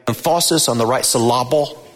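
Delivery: "emphasis" and "syllable" stressed on the second syllable, em-FAH-sis, sil-LAH-bul; misplaced syllable stress. Retold or imitated. Preacher quoting his Greek teacher's joke, "emphasis" and "syllable" stressed on second syllables. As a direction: jokingly shift the stress in "emphasis" and "syllable" to the middle syllable. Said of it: "like my Greek teacher used to say".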